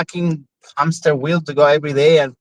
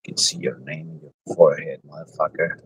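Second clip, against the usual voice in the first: deep put-on voice; 10 semitones lower, 6 dB quieter than usual.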